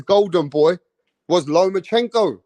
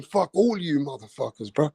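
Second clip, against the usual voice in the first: softer, lower, a thought under the breath; inner voice; 4 semitones lower, 7 dB quieter than usual. Retold or imitated. Teofimo's inner thought about his doubters, hushed and low. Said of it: "in the back of his brain".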